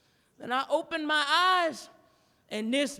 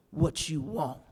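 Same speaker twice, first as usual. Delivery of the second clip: irritated; 8 semitones lower, 4 dB quieter than usual. Retold or imitated. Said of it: "She was irritated".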